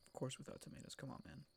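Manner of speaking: mock whisper. Mock scolding in soft voice.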